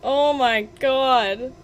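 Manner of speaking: loud, high, drawn-out cry of relief; shouted in triumph; elated relief. Real, not acted. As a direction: let it burst out after a long fight. Just beat the hard boss.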